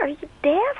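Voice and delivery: high, disbelieving snap; mock the silly question; incredulous retort. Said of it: "And I'm like".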